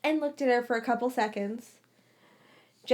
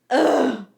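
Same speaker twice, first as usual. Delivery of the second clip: exasperated groan; 2 semitones higher, 9 dB louder than usual. A narrator's reading. Lily exasperated.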